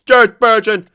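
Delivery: yelled out high and loud in outrage; angry shout. Real, not acted. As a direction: bellow the name like he hates him. Angry at rival player's name.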